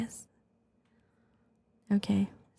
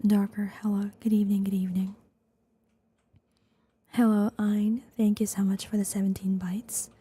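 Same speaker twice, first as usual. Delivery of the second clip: ASMR whisper. Real, not acted. ASMR streamer thanking viewers, whispering.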